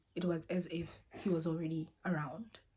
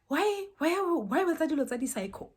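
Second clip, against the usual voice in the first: high, loud angry demand; angry confrontation; 9 semitones higher, 5 dB louder than usual. Retold or imitated. Aunt on the phone accusing the man, loud and high. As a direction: accuse him hotly.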